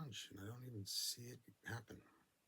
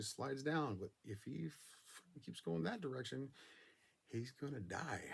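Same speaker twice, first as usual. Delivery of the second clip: excited retelling; 5 semitones higher, 4 dB louder than usual. Retold or imitated. Reliving near-death moments, rising excitement.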